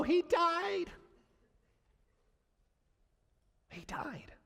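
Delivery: high cry, then a stunned near-silent repeat. Acted. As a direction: drop from high disbelief to a hushed whisper. Stunned news, repeated hushed.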